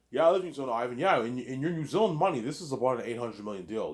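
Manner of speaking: high, loud eager voicing of both sides; hyped-up retold negotiation; excited dealmaking. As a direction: play the exchange up high and loud. Acting out negotiation with Peter.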